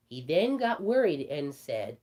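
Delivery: plain reading voice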